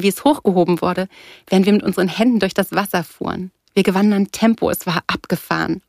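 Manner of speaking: plain reading voice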